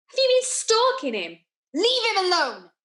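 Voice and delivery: high-pitched, indignant challenge to a rival; indignant confrontation. Acted. Confronts rival in retold quarrel.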